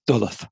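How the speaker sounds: "Duluth" said as DULL-uth, stress on first syllable; Midlands British mispronunciation. Shown as a demonstration. Podcaster admitting an old misreading, "Duluth" mispronounced as DULL-uth. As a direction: say "Duluth" to rhyme its start with "dull". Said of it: "Midlands British way of saying it".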